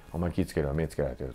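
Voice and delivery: low, hushed senior's warning, re-enacted; 先輩の忠告. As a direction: quietly warn the junior, keeping pitch down. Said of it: "一個上の先輩に、". (一個上の先輩の忠告を低い小声で再現)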